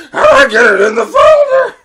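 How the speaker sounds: very high, loud screeched question; screaming child. Retold or imitated. Child demanding help with her folder, screaming. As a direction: scream it in a full tantrum. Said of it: "She's just screaming".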